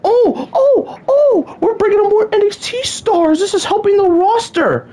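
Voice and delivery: a sneering high-pitched imitation of the company line; mock cheerful. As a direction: parody an upbeat company spin, voice up high. Mocking WWE's booking logic.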